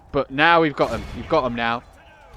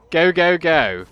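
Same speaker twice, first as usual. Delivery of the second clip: urging shout; 4 semitones higher, 7 dB louder, slower than usual. Real, not acted. Urging units into battle.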